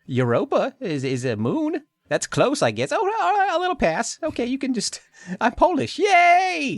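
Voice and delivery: very high, excited alien voice; alien girl voice. Pretend. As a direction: squeal it out like a cheerful alien. Voices Eve in the roleplay.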